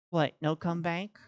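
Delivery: normal talking voice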